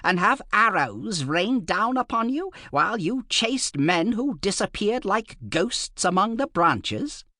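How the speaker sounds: high, scornful and nasal; mock the foolish lord's plan; harsh nasal voice. A narrator's reading. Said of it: "He had a harsh, nasal voice".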